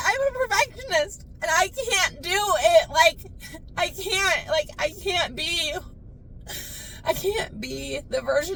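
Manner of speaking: high, crying confession; tearful breakdown. Acted. Admitting she can't be that mom, tearful. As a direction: plead through tears in a high voice. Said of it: "I'm probably gonna cry".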